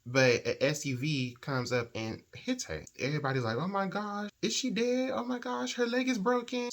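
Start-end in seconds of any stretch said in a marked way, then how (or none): 3.6-6.7 very high, squealed alarm; mock-horrified bystander, pitch way up; imitated panic. Bystanders seeing her hit by an SUV, high-pitched panic. Voicing shocked bystanders after the crash.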